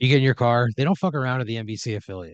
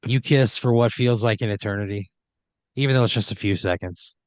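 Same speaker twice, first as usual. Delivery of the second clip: ordinary delivery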